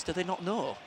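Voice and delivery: normal talking voice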